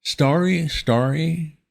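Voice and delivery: high, slow, fumbling toff; flustered young gentleman. A narrator's reading. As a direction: stammer like a rattled young gent. Wooster voiced in story.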